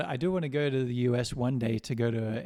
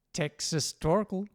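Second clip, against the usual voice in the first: Texas Torkel; 5 semitones higher, 4 dB louder, slower than usual. Shown as a demonstration. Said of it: "Americans have a particular way of, you know, overpronouncing words".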